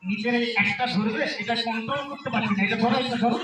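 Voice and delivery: put-on slurred drunk, low and rambling; town drunk. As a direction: play the tipsy husband fumbling. Drunk husband fumbling with bottle.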